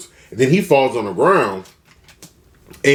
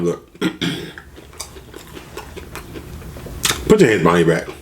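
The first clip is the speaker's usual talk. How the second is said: lower, firm police command; fed-up officer; 4 semitones lower, faster than usual. Retold or imitated. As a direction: lay down the order, out of patience. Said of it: "he's just fed up".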